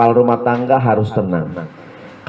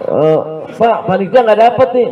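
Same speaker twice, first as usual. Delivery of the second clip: 1 high mock alarm; 10 semitones higher than usual. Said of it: "committee complains".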